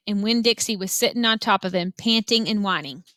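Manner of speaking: ordinary delivery, nothing marked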